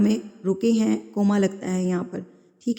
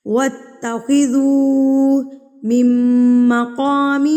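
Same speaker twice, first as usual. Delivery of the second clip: slow, louder tajweed chant; Quran recitation; 4 semitones higher, 6 dB louder, much slower than usual. Shown as a demonstration. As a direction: recite clearly and slowly. (تلاوت کا انداز)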